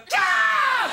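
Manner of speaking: very high, loud shrieked scream, a different cry of alarm; mock scream of horror. Storyteller imitating the crowd's squeal turning into a horrified scream, loud. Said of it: "って感じの悲鳴が変わって".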